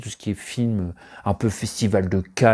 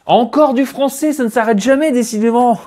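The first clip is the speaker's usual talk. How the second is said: mock exasperated surprise; 12 semitones higher, 6 dB louder than usual. Real, not acted. Surprised at more French films.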